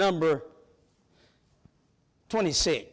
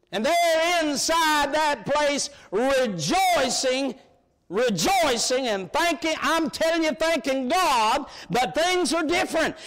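fervent preaching; 5 semitones higher than usual. Real, not acted. Preacher lifting the congregation, slow fervent high preaching. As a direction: stretch each word out and lift the church.

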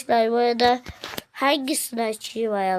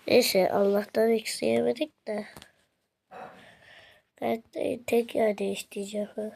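ordinary delivery

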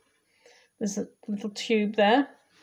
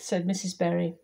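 plain reading voice

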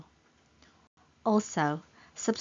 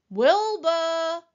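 high, loud, drawn-out name; calling out; 9 semitones higher, 8 dB louder than usual. A narrator's reading. Elmer calls to his hidden friend, calling out. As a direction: holler the name into the distance. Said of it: "called Elmer".

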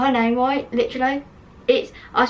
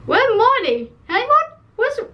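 confused high calling; 11 semitones higher than usual. Real, not acted. Searching for a missing name.

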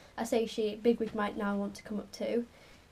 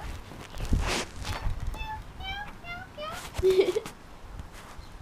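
high laugh. Real, not acted. Laughing at the cat.

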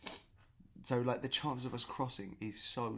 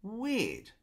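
weirded out; 7 semitones higher, 3 dB louder, much slower than usual. Retold or imitated. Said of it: "but I was just like".

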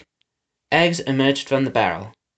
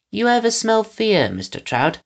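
higher-pitched henchman asking a question; Pickles, inquisitive; 8 semitones higher than usual. A narrator's reading. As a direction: lift the voice for a curious henchman.

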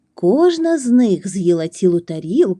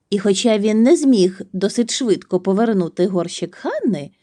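ordinary delivery, nothing marked

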